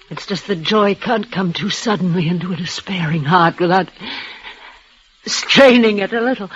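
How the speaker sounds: usual speaking voice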